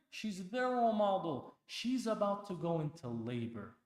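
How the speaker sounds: high, loud pulpit exhortation; impassioned sermon peak; fiery preaching. Acted. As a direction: drive it home to the congregation. Preacher rising to full force.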